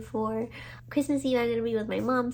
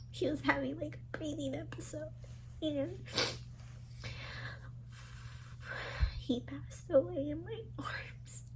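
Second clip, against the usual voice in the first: tearful whisper; 5 semitones higher, 11 dB quieter than usual. Real, not acted. Telling of cat dying in arms.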